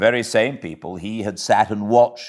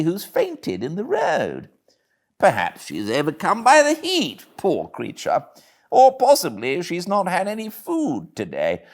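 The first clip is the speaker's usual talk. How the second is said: lightly raised, sympathetic gentleman's voice; a hint of a concerned gentleman; kindly gentleman; 6 semitones higher than usual.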